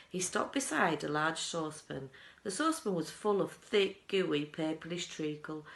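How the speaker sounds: usual speaking voice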